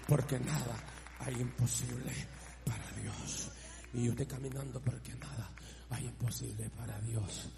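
low, soft, repeated declaration; hushed preaching. Real, not acted. Preacher answering trouble with the verse, hushed preaching. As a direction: keep it deep and subdued, phrase by phrase.

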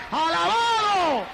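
drawn-out shouted praise. Real, not acted. Preacher rousing the congregation to praise, long shout. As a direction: belt it out slowly to rally the church.

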